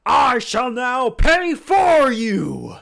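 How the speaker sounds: booming, slow, overjoyed himbo; dopey sweet himbo. Acted. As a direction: shout it like a delighted big lug. Bang offers loudly.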